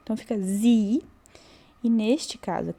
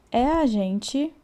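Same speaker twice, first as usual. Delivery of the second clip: usual speaking voice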